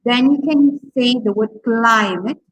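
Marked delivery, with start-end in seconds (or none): 1.6-2.4 CLIMATE with CLI- held long and strong; longer, stronger first syllable. Shown as a demonstration. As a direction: lean hard on the CLI in climate. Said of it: "longer and stronger".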